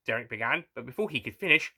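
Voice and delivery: usual speaking voice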